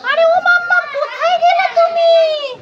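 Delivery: put-on high, loud child's yell for mum; calling out. Child yelling for mummy to come quickly, high and loud. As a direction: shout for mummy across the house.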